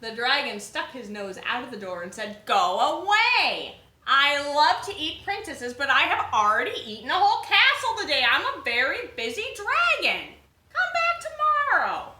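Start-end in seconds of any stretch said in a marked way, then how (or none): 2.4-10.3 pompous dragon. Acted. Dragon sends princess away. 10.7-12.1 much higher, slow, squeaky dragon dismissal. Acted.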